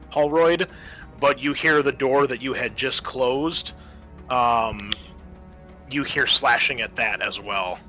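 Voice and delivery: ordinary talk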